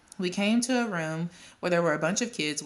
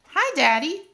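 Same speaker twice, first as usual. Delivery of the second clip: put-on much higher, loud small child; little kid voice; 12 semitones higher, 8 dB louder than usual. A narrator's reading. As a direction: chirp it like a toddler. Small child greets father.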